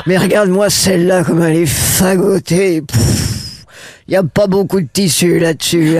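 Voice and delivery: put-on prim old lady gossiping on a bench; tut-tutting old grandma, catty and sweet; grandmother voice. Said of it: "elle peut très bien être assise sur le banc et dire des trucs du style".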